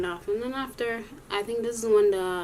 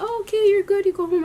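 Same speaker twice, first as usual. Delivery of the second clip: mock sweet teacher; 11 semitones higher, 5 dB louder than usual. Mimicking the teacher giving in.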